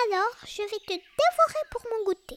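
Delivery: high-pitched, put-on tigress voice; threatening tigress. A narrator's reading. Tigress threatens to eat Lulu, ferocious. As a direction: threaten in a high big-cat character voice. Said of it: "dit la tigresse".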